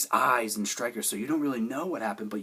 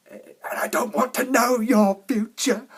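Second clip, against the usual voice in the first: high, loud, slow cry; retold outburst; 13 semitones higher, 11 dB louder, slower than usual. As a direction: shout it slowly, pitched way up. Acted outburst of a film line.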